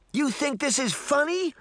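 very high indignant question; high-pitched fury without much extra volume; exploding in outrage. A narrator's reading. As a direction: burst out incredulously, pitch right up. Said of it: "Sabrina exploded".

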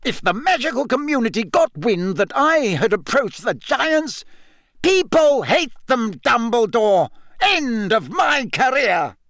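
high, agitated objection; heated, flustered outburst; appalled protest. A narrator's reading. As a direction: argue in alarm, pitch way up. Fudge appalled, protesting about his career.

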